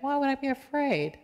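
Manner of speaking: puzzled. Retold or imitated. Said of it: "You're like".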